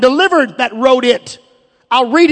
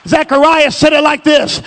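ordinary talk

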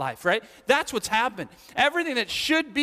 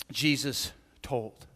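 usual speaking voice

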